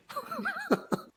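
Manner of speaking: very high, loud burst of laughter. Real, not acted. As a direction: let out a squealing laugh. Laughs at prince revelation.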